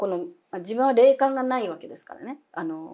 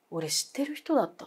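hushed whispered confession; frightened whisper; 4 semitones higher, 4 dB quieter than usual. Retold or imitated. Scared roommate confiding who he saw, whispered. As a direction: whisper it as if afraid to be heard.

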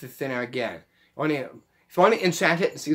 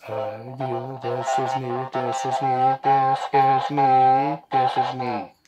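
robotic voice-changer voice; slower than usual. Shown as a demonstration. Demoing the gadget to viewers, robotic voice. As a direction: talk through a robot voice changer. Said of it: "press the down button here as you talk".

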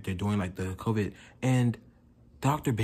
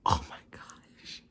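overwhelmed whisper; 7 dB quieter, slower than usual. Real, not acted. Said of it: "cry some more".

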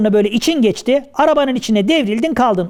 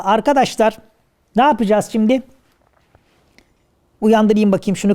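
normal talking voice

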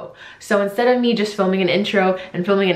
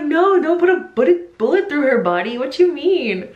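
mock protest; 7 semitones higher than usual. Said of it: "I'm like".

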